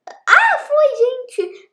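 high thrilled cry of success; excited squeal. Real, not acted. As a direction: squeal with delight at finally managing it. Towel finally lands on hook.

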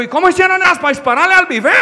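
high, incredulous mock outburst; baffled grandfather. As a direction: demand it like an astonished grandpa. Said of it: "mi abuelo dijo".

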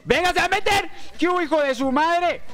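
high, loud hype yell; hyped shout. Real, not acted. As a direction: yell it like a big play. Gaming hype moment.

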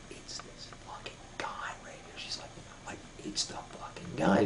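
barely audible, secretive whisper; conspiratorial whisper. Retold or imitated. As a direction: whisper it discreetly to the bartender. Discreetly points out man to bartender.

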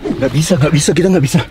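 loud, breathless panic; being chased. Real, not acted. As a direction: shout while fleeing. Escaping after failed grab. (teriak panik sambil kabur)

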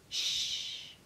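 soft whispered shush; hushing. Acted. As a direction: hush the listeners so the giant sleeps. Giant asleep, hushing listeners.

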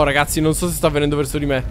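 usual speaking voice

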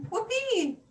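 high, loud whoop; joyful cheer. A narrator's reading. Katy rejoices at gaining a friend.

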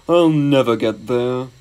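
slow, drawn-out engine lament; sad. A narrator's reading. Gordon giving up on reaching London, sad. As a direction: draw it out dolefully. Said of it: "he thought sadly".

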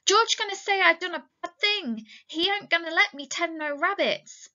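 very high, fretful character voice; acted panic, without sobbing; plaintive wail of worry; frightened pleading. A narrator's reading. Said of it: "began to cry with fright".

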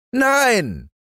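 put-on high, defiant child's yell; boy yelling no. Häwelmann refusing the moon, high defiant child yell. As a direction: shout it like a stubborn little boy. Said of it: "schrie Häwelmann".

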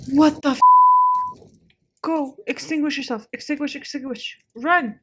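frantic panicked shouting; being chased. Real, not acted. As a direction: yell orders in a panic. Panicking as character burns.

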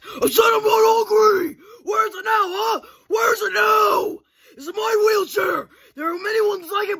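high, loud, tearful screaming; crying rant. Acted.

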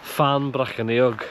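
Fan Brycheiniog in an attempted Welsh accent, slow, ch as a hard k. Shown as a demonstration. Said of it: "That's my best Welsh accent".

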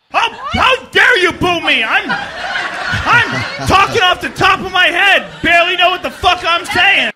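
high-pitched, drawn-out comic shout; mock outrage. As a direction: yell at the hecklers in mock indignation. Performer lashes out at booing audience.

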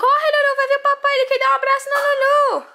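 high and loud, pushing her to run; excited urging. Real, not acted. Mom urging little Lulu to run to daddy, excited.

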